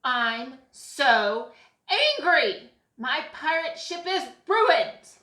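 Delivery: put-on loud, furious child outburst; angry yell. A narrator's reading. Boy whose pirate ship is ruined, angry yell. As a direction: yell it, leaning on each word. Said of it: "and yelled".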